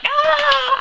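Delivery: very high mock squeal of delight; excited squeal. Fan retelling her joy at the Amsterdam scene, excited squeal. Imitates own giggly delight at film.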